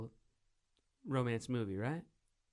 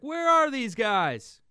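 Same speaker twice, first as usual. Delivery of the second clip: high, loud, drawn-out mock complaint; imitated puzzled listeners; 9 semitones higher, 7 dB louder, much slower than usual. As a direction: slowly call it out as baffled listeners. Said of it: "They're like".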